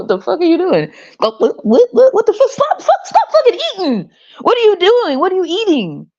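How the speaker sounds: high, incredulous yelling over laughter; shocked disbelief. Retold or imitated. Speaker reacting to people eating flesh, shocked and high.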